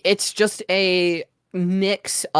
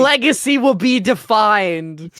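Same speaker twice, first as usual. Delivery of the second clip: slow, grand hype-trailer voice; epic announcer voice; 7 semitones higher, 8 dB louder, slower than usual. Pretend. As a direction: boom it out like an esports promo. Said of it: "all that weird mumbo jumbo people say".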